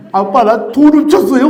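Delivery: loud, high mock wail; crying-out confession, hammed up; imitated tearful confession. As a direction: blurt it out like a bawling child. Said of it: "막 울면서 막 얘기를 하게 된 거예요".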